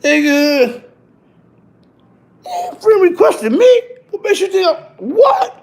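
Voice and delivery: high, loud outraged What?; shocked disbelief. Retold or imitated. To the rat who friend requested him, shrieking disbelief. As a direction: squeal it in disbelief at the picture. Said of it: "I'm looking at the picture like".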